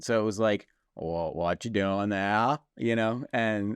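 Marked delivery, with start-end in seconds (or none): 1.0-2.6 slow, low Maine drawl; slow lower Maine accent. Retold or imitated. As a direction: imitate a backwoods Maine guy asking, slowly. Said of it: "my only point of reference for the Maine accent".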